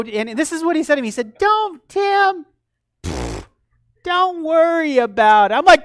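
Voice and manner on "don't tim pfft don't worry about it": high, loud brush-off with a scoffing pfft; dismissive brush-off. Retold or imitated. Mentor brushing off Tim's question, dismissive. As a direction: wave away the worry, voice pitched up. Retold dismissal of his worry.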